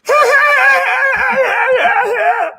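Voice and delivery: high, loud cackle bursting out; helpless screaming laughter; loud shrieking laugh. Real, not acted. Cracking up at crackhead story.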